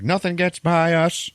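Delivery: high, slow, hammed-up proclamation; mock emphatic boast. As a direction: crow it like an imitated boss. Boss's boast acted out in retelling.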